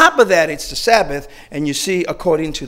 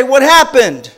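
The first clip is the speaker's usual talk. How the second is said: stern demand; 7 semitones higher, 9 dB louder, slower than usual. Retold or imitated. Pharisees interrogate.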